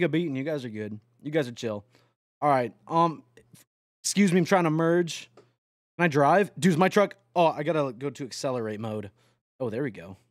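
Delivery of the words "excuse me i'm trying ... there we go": ordinary talk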